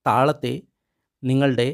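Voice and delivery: ordinary talk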